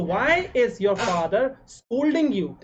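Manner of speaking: drawn out, each word separate; slow dictation. Shown as a demonstration. Teacher dictating the answer to students, very slowly. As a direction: read it slowly so students can write. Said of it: "teacher dictating the English sentence".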